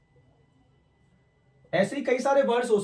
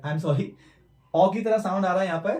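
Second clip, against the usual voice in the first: ordinary delivery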